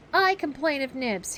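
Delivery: quick, eager cry. Acted. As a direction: jump in to complain. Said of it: "he cried promptly".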